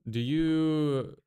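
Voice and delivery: ordinary delivery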